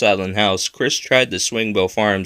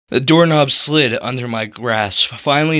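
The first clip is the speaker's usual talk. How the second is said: ordinary delivery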